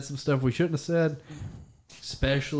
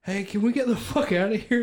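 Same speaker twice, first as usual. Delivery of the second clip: acted-out whiny plea, pitched up; high mock intruder; 9 semitones higher, 3 dB louder than usual. Kid retelling the intruder's line, pitched up. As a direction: voice the guy under the bed, higher. Intruder voiced in retold story.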